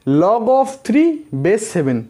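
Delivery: extremely slow, every word stretched; slow demo reading. Shown as a demonstration. Teacher repeating the log reading for the class, extremely slowly. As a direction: drag out "Log of three base seven" word by word. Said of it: "इसको हम कैसे करके पढ़ेंगे?".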